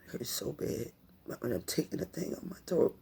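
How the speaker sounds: usual speaking voice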